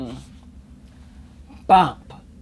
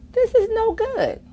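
Ned complaining; 3 dB louder than usual. Acted.

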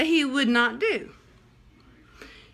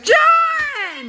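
loud call across the room; shouting; 11 semitones higher, 5 dB louder than usual. Real, not acted. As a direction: call out a name. Calling out.

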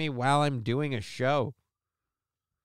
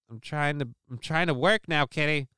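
normal talking voice

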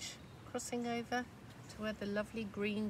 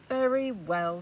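louder reluctant agreement; grudging troll; 9 dB louder, slower than usual. Acted. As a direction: give in grumpily. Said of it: "said the troll".

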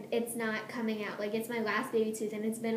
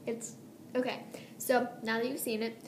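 ordinary delivery

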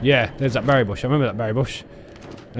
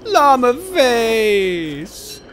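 high, loud, very slow holler; silly drawn-out call; 8 semitones higher, 5 dB louder, much slower than usual. Real, not acted. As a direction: stretch both words out in a loud, high call. Same name repeated louder.

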